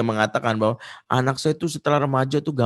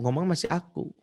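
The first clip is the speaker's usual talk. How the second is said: ordinary talk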